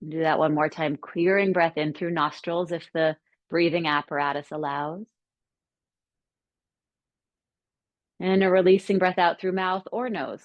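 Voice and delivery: calm, unhurried settling-in guidance with long pauses; guided meditation. Acted. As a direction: guide them gently into stillness, leaving space between phrases.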